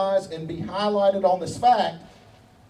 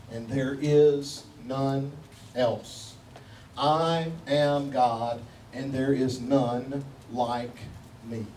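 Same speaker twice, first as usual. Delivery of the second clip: low, very slow, spaced-out "I am God"; emphatic; 7 semitones lower, 3 dB quieter, slower than usual. Acted. Preacher reading God's words in Isaiah, emphatic, low and measured. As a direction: weigh each word of "there is none like me", low and slow. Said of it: "The emphasis is telling".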